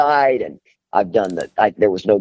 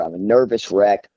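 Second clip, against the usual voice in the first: normal talking voice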